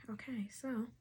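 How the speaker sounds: soft whisper to herself. Real, not acted. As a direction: whisper it before starting. Whispered before recording.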